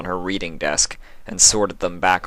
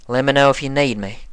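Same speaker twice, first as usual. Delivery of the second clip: a tough farm pony voice; plain-spoken Applejack voice; country farm mare; 6 semitones higher, faster than usual. Acted.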